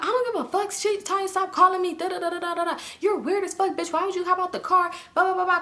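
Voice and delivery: high-pitched dismissive tirade trailing into babble; mock angry retort. [name] snapping back at callers, high mock anger.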